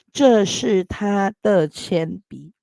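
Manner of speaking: 这是他的铅笔 read slowly, word by word; teacher reads 这是他的铅笔 slowly for students, slow model sentence. Shown as a demonstration. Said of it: "this translate".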